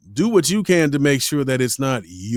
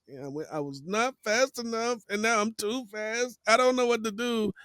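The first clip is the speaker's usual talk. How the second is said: high, softer mock fretting; panicked inner voice; 10 semitones higher, 4 dB quieter than usual. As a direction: voice your own flustered thoughts, small and high. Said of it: "Now in your head, you're like".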